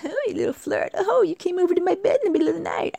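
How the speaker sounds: high, airy, teasing coo. Retold or imitated. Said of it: "maybe young girls do that".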